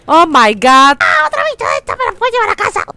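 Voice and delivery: very high, loud panicked shrieks; frightened shriek. Real, not acted. Gamer chased again, shrieking in fright.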